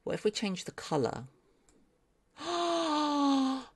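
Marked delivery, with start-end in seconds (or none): 2.4-3.6 gasp, then a hushed whisper; barely audible, awed whisper; awestruck gasp. Real, not acted. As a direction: breathe it out in sheer amazement. Awed by the colour change.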